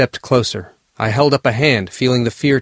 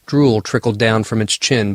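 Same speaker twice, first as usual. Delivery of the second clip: plain reading voice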